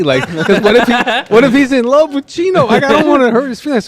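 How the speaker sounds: high-pitched anxious fretting, played for laughs; mock nervous worry. Storyteller imagines answering Loco about Chino, high mock worry. Acted awkward reply about friend's looks.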